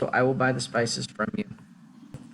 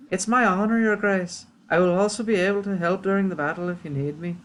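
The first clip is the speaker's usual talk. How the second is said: higher, lilting put-on accent; helpful witch; 7 semitones higher than usual. Witch offering the kingdom aid, helpful accented voice.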